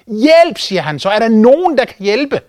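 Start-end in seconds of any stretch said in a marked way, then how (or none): 0.1-0.6 high, loud call for help; cry for help. Acted. Man hanging over an abyss, calling out for help. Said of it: "Så han begynder at råbe om hjælp". 1.1-2.4 high-pitched call for anyone to help. Acted. Stranded man to anyone above, calling out high. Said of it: "Så han begynder at råbe om hjælp".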